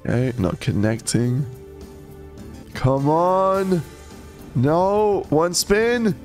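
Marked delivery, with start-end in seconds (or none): none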